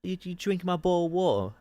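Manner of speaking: high, hammed-up British yob; mock British accent. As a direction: scold in an exaggerated, high-pitched British accent. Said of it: "A British person is like".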